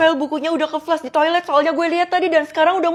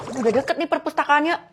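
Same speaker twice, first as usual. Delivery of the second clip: normal talking voice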